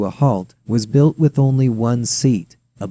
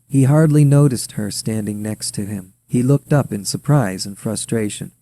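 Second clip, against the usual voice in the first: ordinary delivery, nothing marked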